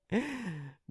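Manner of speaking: high, soft gloating giggle; amused chuckle. Acted. Bully amused by a victim resisting, soft high chuckle. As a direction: chuckle softly at their resistance.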